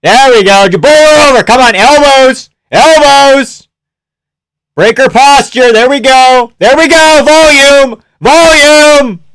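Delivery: high, loud bursts of instruction; excited coaching yells. Real, not acted. Fan coaching the fighter from the couch, excited yelling. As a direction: yell corner advice at your fighter. Shouting corner advice at screen.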